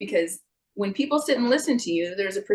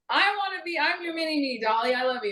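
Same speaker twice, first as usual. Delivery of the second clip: high, gushing fan voice to her hero; 7 semitones higher, 3 dB quieter than usual. Retold or imitated. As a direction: squeal it like a starstruck fan. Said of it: "I go".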